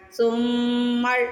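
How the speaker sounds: سمر with the م held through the nose; ghunna on meem. Shown as a demonstration. Teacher demonstrating ghunna in a word for students. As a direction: hold the م of سمر nasally, very slowly. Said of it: "پھر را کو پڑھنا ہے".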